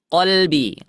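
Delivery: slow "Ya qalbi", q from the back of the throat; Arabic qaf. Shown as a demonstration. Teacher shows students the qaf in "qalbi", slow and careful. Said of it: "Kalau qalbi huruf apa?".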